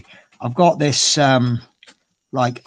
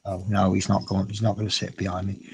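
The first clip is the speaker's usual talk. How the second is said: ordinary talk, nothing marked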